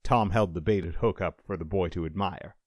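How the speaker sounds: ordinary delivery, nothing marked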